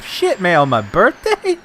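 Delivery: high, indignant retold complaint; mock indignation. Birthday guy protesting the price, high mock outrage.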